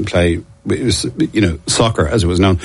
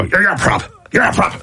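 mock accusation; 10 semitones higher than usual. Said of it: "And you go".

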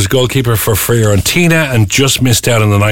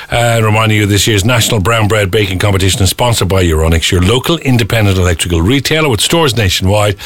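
radio ad read; 5 semitones lower than usual. Acted. Sponsor read on air.

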